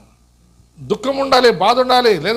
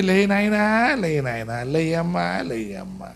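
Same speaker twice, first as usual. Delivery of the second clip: softer, fading mock wail over the dead; mock lament; 4 dB quieter, much slower than usual. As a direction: imitate a mourner pleading with the corpse to rise. Imitating mourners waking the dead.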